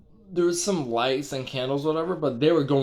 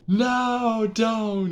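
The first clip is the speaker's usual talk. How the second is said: pleading no; 9 semitones higher than usual. Retold or imitated. Dreamer protests friend's sacrifice.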